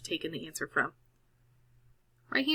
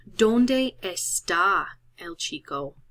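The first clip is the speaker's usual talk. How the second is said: slow Spanish reading; 4 dB louder, slower than usual. Shown as a demonstration. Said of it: "teacher reads question slowly".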